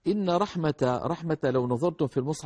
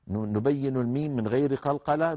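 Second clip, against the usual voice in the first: ordinary talk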